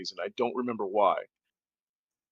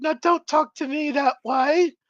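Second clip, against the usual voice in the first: much higher, slow, scolding English mom voice; a prim mother's shocked rebuke; imitating his mom; 14 semitones higher than usual. Narrator imitates English mom scolding her son at the car.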